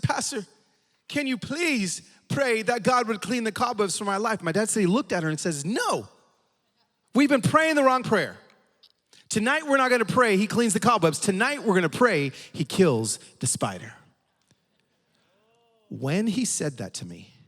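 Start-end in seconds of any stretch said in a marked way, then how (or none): 0.0-4.4 high, slow, drawn-out plea; tearful old lady. Retold or imitated. Old lady asking one more time, high slow mock-tearful plea. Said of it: "she is still crying". 5.6-13.9 higher, preachy declaration; emphatic correction. Retold or imitated. Pastor correcting the old lady's prayer, emphatic and raised.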